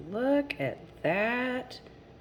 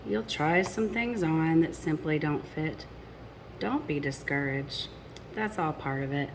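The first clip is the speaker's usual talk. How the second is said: lilting rhymed storybook read; reading to children. A narrator's reading. Parent reading a picture book to children, lilting rhyme. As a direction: read the rhymes to the little ones.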